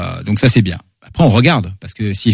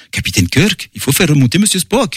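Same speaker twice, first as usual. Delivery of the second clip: hammed-up Russian accent, rising higher; Tchekhov; 5 semitones higher than usual. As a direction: imitate a cartoonish Russian officer calling his captain. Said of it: "l'accent de Monsieur Tchekhov". (accent russe caricatural, voix qui monte)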